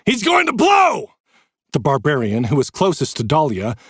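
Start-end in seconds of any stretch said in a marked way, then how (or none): 0.0-1.1 high, loud alarm; shouted. A narrator's reading. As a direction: warn everyone, yelling. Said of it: "Jay shouted".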